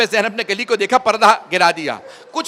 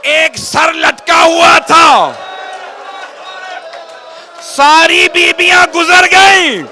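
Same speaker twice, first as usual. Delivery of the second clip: loud, high, wailing preacher's lament; rising grief-stricken sermon, loud and slow; majlis recitation at full force; 8 semitones higher, 6 dB louder, slower than usual. Acted. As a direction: cry the lament out to the mourners.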